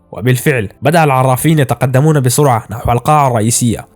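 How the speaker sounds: ordinary delivery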